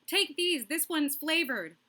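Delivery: high, pleading teacher voice; a teacher about to crack, pushing condoms; frantic health teacher. Pretend. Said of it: "high school health teacher on the verge of a breakdown".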